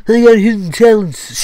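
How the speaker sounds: high, loud cocky brag; hammed-up smug girl; smug character voice. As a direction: gloat like you're showing off. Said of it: "she grinned".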